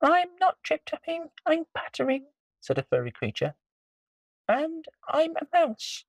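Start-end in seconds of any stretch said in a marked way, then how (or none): 0.0-2.3 very high little mouse; squeaky mouse voice. Acted. Mouse answers troll. 4.5-6.1 very high little mouse; squeaky mouse voice. Acted. Mouse speaks.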